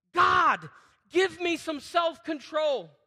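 loud, slow plea; fervent prayer. Shown as a demonstration. Pastor leading the church in prayer, slow and loud. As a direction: cry out for self-control.

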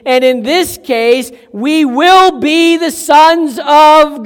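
loud, slow, rising pulpit fervor; fervent preaching. Acted. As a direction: preach it out to the congregation. Preaching at full force.

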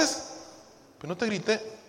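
negación muy lenta, bajando el volumen; niégalo suave y alargado; defensa del esposo. Acted. Acted husband denies having shouted. (defensa contenida, actuada)

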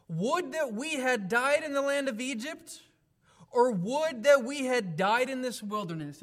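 high, loud scripture quote; loud complaint. Acted. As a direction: cry out the grievance in a preacher's big voice. Acts out congregation's complaint to Moses.

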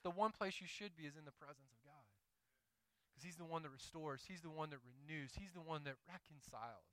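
hushed sermon reassurance; quiet preaching. Acted. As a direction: drop down and speak it gently.

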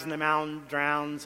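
normal talking voice

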